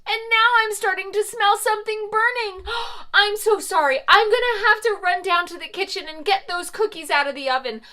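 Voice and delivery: high, loud, fast fluster; hurried alarm. Acted. Mother Goose hurries to rescue her burning cookies. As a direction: rush off in a panic. Rushing to the kitchen.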